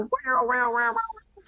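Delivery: very high squealing wows, a mock child's shriek; imitating a toddler's squeal. Toddler running down the aisle during the sermon, mock high squeal. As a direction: squeal like a giggling two-year-old. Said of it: "one of our kids comes screaming".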